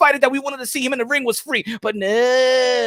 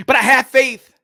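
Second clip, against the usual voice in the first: hammed-up sermon, slow and laughing through it; mock preaching; 3 semitones higher than usual. Preaching to the viewers, laughing mock sermon. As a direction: preach it big, cracking up between words. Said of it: "We preaching here".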